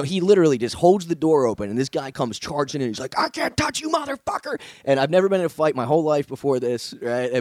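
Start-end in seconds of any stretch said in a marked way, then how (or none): 3.2-4.6 high strained yelling threat; mock attacker scream. Attacker charging into the lobby, high mock scream. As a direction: shriek it as the crazed guy charges in.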